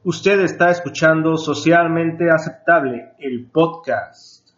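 radio announcer. Acted. Cortinilla del podcast.

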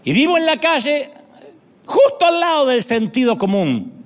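high, loud, stretched street call; Lady Wisdom shouting. Acted. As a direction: cry out the warning to the foolish crowd. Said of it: "Está hablando la sabiduría, no yo". (la sabiduría gritando en la plaza, aguda y fuerte)

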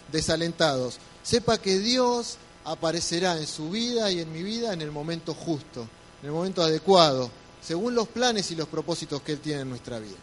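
ordinary delivery

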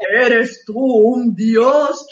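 slow, loud distorted demon voice; lightly mimicking a voice changer. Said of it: "Y la misma voz".

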